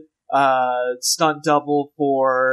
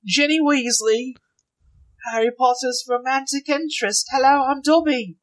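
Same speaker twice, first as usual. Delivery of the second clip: high put-on British girl voice; squeaky fake English schoolgirl; mock British girl; 9 semitones higher than usual. Mimicking Katie's accent for the listener, high and put-on.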